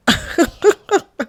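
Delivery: high burst of laughter. Real, not acted. Amused at his suddenly changed phone voice, high laugh.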